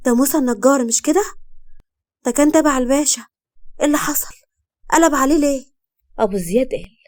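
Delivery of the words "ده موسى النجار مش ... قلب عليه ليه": higher-pitched, startled questioning; shocked. A narrator's reading. Ghalia asking Abu Ziad about Musa, shocked and high. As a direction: ask in raised, shocked disbelief. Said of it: "اتصدمت وقالت لأبو زياد".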